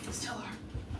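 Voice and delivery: unvoiced muttered "Still are."; whispered repeat. Real, not acted. Repeats phrase, second whispered.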